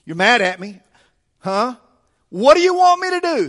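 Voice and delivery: put-on loud teen back-talk, pitch leaping at the end; sarcastic teenager. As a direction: challenge like an indignant teenager. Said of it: "this sounds like a teenager".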